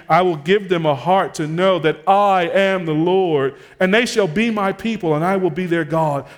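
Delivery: high, loud pulpit delivery; forceful sermon voice, pitched well up; impassioned preaching. Real, not acted. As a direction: proclaim scripture with rising fervor. Preacher builds through scripture citation.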